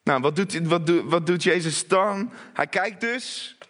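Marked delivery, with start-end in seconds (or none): none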